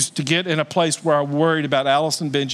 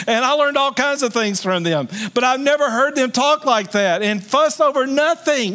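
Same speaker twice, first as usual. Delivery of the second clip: rising preacher fervor; 8 semitones higher than usual. Real, not acted. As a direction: pitch climbing high as the point builds. Preacher heats up over parents' quarrel.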